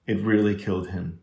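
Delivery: plain reading voice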